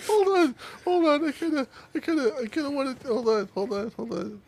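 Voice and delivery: high-pitched, half-laughing; high squeaky voice. Real, not acted.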